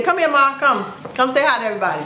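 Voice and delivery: usual speaking voice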